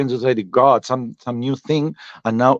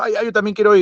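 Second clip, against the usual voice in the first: high-pitched, pleading Ay, ay; eager whiny friend; 8 semitones higher, much faster than usual. Retold or imitated. Friend begging to join the livestream, high and pleading. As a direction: beg to come along like a child. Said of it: "Le dijo".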